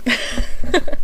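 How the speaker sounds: breathy laugh. Real, not acted. Laugh before sniff.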